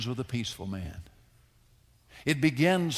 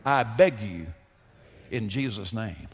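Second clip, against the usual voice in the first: louder, measured call-and-response prayer; leading a prayer; 6 dB louder than usual. Shown as a demonstration. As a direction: lead the class line by line in prayer. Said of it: "Say after me, class".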